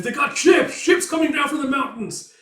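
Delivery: high, loud panicked yell; alarmed shout. Acted. Soldier sighting enemy ships.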